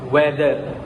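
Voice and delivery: "weather" with a hard D for the TH; Filipino pronunciation. Shown as a demonstration. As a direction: say "weather" as "we-der". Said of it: "In Filipino language".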